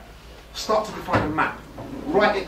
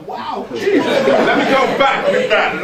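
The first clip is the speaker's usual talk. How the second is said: embarrassed laugh; 8 semitones higher, 6 dB louder, much slower than usual. Real, not acted. Embarrassed by slip.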